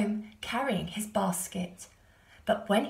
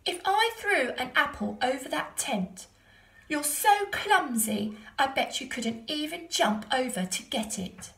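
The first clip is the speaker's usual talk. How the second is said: mock the kangaroo sweetly; cheeky boy taunt; 6 semitones higher, 3 dB louder than usual. A narrator's reading.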